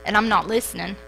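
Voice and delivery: ordinary talk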